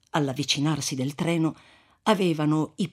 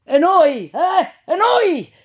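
high, loud demanding cries; clamoring shout; 12 semitones higher, 10 dB louder, slower than usual. Acted. Other corpse-carriers demanding the flask, loud clamor. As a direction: shout from the cart ahead for a turn. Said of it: "gridaron più voci dal carro".

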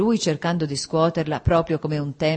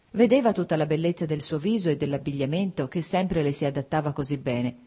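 usual speaking voice

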